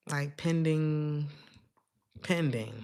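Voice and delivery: slow, stretched pending then a dropped pending; drawn-out pending. Shown as a demonstration. Reading a pending situation, slow and stretched. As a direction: draw pending out slowly, then drop it.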